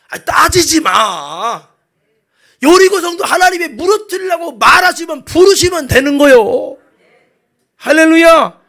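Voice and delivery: loud, high proclamation of God's power; full-force preaching. Real, not acted. Preacher proclaims the God of miracles to congregation, full-force preaching. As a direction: preach it in a ringing shout.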